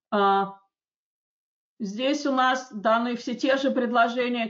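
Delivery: ordinary delivery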